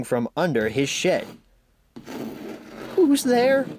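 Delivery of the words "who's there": ordinary delivery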